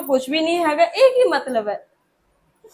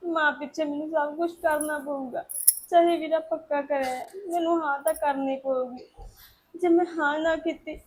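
much softer, weeping; tearful monologue; 7 dB quieter than usual. Acted. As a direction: cry quietly alone.

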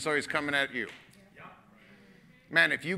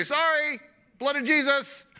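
pleading; 11 semitones higher, 4 dB louder than usual. Pretend. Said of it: "hit your knees and say".

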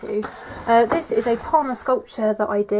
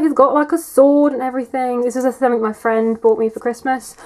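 ordinary talk

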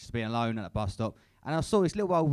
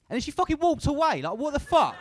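higher, louder indignant outburst; mock outrage; 12 semitones higher, 6 dB louder than usual. Real, not acted. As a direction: burst out in comic disbelief. Outraged punchline.

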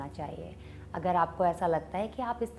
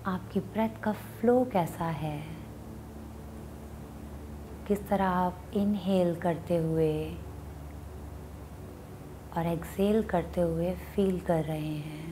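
guided meditation; 2 semitones lower than usual. Acted. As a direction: guide them unhurriedly into watching the breath. Leads a meditation.

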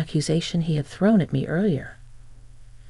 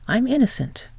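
high, insistent cry of innocence; pitched-up protest; 7 semitones higher than usual. Acted. Grabbed man protests to his captor, pitched-up and insistent. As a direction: pitch it up as he pleads he's innocent.

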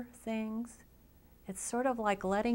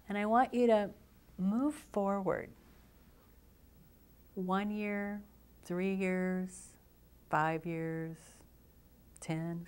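guided visualization. Acted. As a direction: guide them gently into relaxation, phrase by phrase.

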